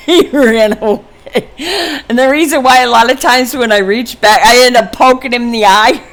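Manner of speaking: talking through real laughter; laughing. Real, not acted. Mother amused as her son runs away, laughing. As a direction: let laughs break into the words.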